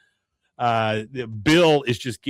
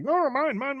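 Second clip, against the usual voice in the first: high greedy cry; imitating greedy men, high; squabbling guys. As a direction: squeak like men grabbing. Guys fighting over bucket.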